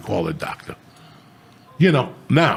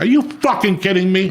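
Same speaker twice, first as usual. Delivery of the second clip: incredulous outrage; 8 semitones higher than usual. Retold or imitated. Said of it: "I'm like".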